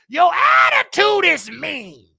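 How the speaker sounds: preacher-style rebuke of a mean woman; scolding. Real, not acted. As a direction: tell her off to her face.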